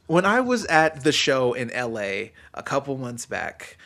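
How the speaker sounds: ordinary delivery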